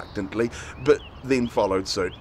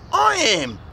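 put-on girl's voice; 11 semitones higher, 4 dB louder than usual. A narrator's reading. Robin claims identity.

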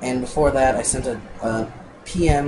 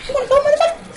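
squeaky falsetto taunt, far above usual pitch; high voice; 4 dB louder, much faster than usual. Pretend. Gamer acting pissy at a creeper, in a silly high voice. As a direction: put on a very high, loud girly voice. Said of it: "Using my high voice".

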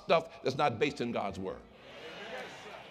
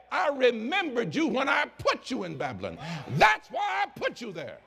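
indignant God; 8 semitones higher than usual. Acted. Preacher voices an indignant God.

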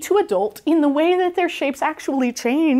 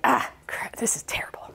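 whispered mock despair, almost crying; whimpering robot; 4 semitones lower, 8 dB quieter than usual. The streamlined robot failing to twirl, whispered whimper.

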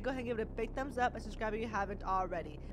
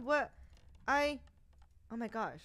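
ordinary delivery

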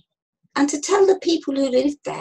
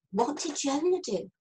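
ordinary delivery, nothing marked

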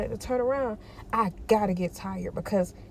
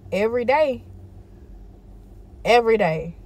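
emphatic every day; 4 semitones higher, 6 dB louder than usual. Real, not acted. Insists on daily effort.

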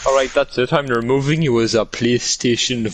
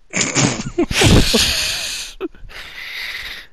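long wheezy high laugh. Real, not acted. Cracking up at his own crude joke, wheezing laugh. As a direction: crack up until the breath runs out.

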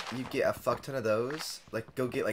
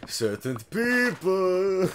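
slow, high, pointed; 8 semitones higher, 6 dB louder, much slower than usual. Acted. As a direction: drag it out knowingly. Pointed joke.

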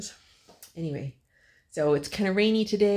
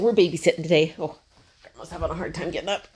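usual speaking voice

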